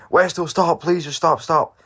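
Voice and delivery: high, loud pleading call; officer calling after the runaway, high and loud. Retold or imitated. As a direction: shout after someone running off. Officer chasing him.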